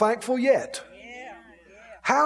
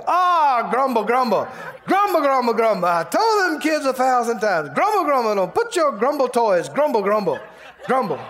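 louder mock grumble about toys left out, voice pitched up; hammed-up grumbling; 2 semitones higher, 3 dB louder than usual. Stubbing a toe on a child's toy, hammed-up complaining grumble.

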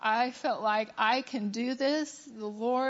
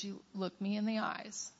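too quiet; 7 dB quieter than usual. Retold or imitated. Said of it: "Say it loud enough everybody can hear".

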